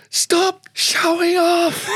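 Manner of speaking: high, slow, booming yell; coach's shout. Retold or imitated. Coach yelling at his player from the box, booming and slow. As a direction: bellow it across the ground. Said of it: "you hear this voice from the tin coach's box".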